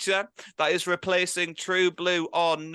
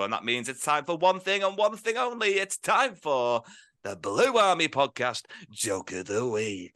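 segment announcer. Acted. Host introducing the weekly joke slot, dramatic announcer.

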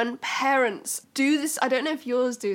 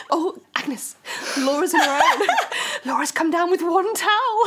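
mock gossiping old lady; 3 semitones higher than usual. Voicing old ladies gossiping.

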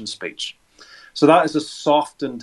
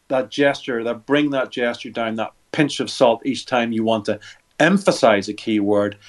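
ordinary talk, nothing marked

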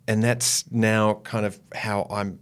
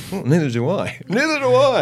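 higher, louder mock voice through laughs; ad character; 11 semitones higher, 8 dB louder than usual. Quoting a beer ad to hosts, laughing. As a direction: ham up the ad line, laughing. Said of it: "that great ad for Canadian Club".

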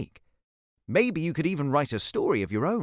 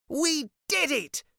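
triumphant call; 11 semitones higher, slower than usual. A narrator's reading. Cece having trapped the dinosaur, triumphant. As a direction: celebrate slowly in a high child's voice. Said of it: "she shouted".

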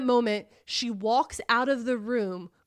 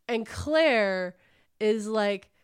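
ordinary talk

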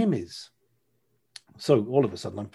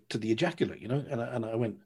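ordinary talk, nothing marked

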